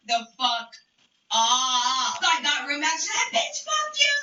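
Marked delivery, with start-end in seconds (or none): 3.4-4.1 high-pitched mimicked cursing; mock the rival's outburst way up high; cussing someone out. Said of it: "she's cussing me out".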